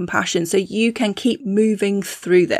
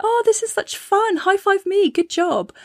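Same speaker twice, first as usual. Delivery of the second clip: high, bouncy, mock-cheerful self-praise; playful self-cheer; 11 semitones higher than usual. Coach to viewers, high-pitched self-praise while laughing at yourself. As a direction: cheer yourself on, tongue in cheek. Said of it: "laughing at yourself and just being like".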